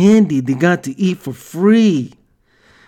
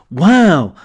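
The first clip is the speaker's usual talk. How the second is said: ordinary talk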